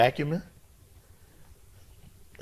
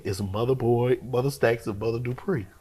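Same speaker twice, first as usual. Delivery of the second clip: ordinary talk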